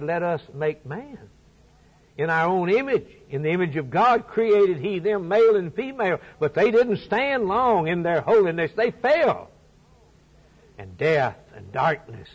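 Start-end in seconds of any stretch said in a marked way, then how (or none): 0.0-9.5 full force preaching. Acted. Preaching creation and fall.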